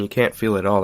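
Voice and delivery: usual speaking voice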